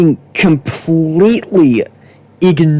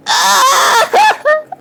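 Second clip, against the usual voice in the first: very high-pitched scream breaking into laughter; frustrated squeal with a laugh; exasperated shriek. Real, not acted. Exasperated shriek ends the rant.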